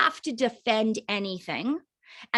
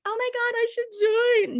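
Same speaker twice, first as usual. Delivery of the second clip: sarcastic mock excitement; 12 semitones higher than usual. Mocking how a prospect would never react, sarcastic gushing. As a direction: ham up fake enthusiasm sarcastically. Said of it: "They're gonna be".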